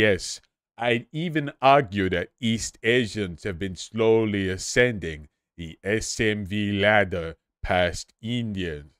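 slow pompous commentator drone; Measurehead impression. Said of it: "I'm just doing Measurehead".

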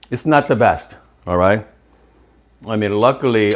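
talking between bites; chewing while talking. Real, not acted. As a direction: mouth partly full. Seen 1: bite taken at -1.0; jaw moving, lips tight while speaking after.

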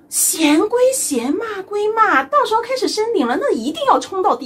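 husband's playful lecture; emphatic advice. Retold or imitated. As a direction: lay down the rule with gusto. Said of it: "他说".

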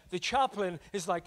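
ordinary talk

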